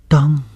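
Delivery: high, loud imitated clink; sharp clang. A narrator's reading. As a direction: ring out a sudden metallic "dang". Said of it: "一声脆响". (突然高声模仿脆响)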